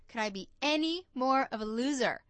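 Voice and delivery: mock despair at yourself; self-mocking. Driver mocking herself after being fired, exasperated. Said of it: "I'm thinking to myself".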